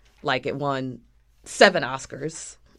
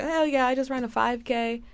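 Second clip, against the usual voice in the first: mock casual; 8 semitones higher, 3 dB quieter than usual. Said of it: "She make it seem so casual".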